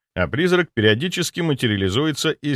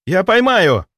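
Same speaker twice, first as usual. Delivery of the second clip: eager shout; 9 semitones higher, 7 dB louder than usual. Acted. Ghost rushes to catch body.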